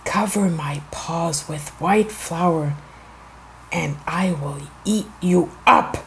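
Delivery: low, soft wolf threat; wicked wolf. A narrator's reading. Wicked wolf to the baker, low quiet menace. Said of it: "wicked wolf went to the baker and said".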